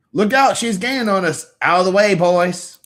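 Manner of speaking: high, loud urgent shout of a comic character; excited race-watcher. Acted. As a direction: call out a warning to the boys, high and loud. Reads comic characters aloud in voice.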